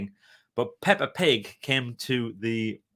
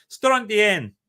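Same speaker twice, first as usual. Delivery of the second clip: Strontian in a hammed-up Scottish accent, high and loud; over-the-top Scottish accent; 9 semitones higher, 7 dB louder than usual. Repeating the place name in a mock Scottish accent. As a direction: roll out Strontian in a thick mock Scottish brogue. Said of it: "with a ridiculous over-the-top Scottish accent".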